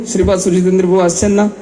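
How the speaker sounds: ordinary delivery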